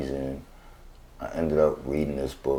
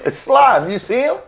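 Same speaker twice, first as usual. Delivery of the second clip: high laughing delight; 9 dB louder than usual. Real, not acted. Guest laughing at the host's cockiness. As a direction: point him out while laughing.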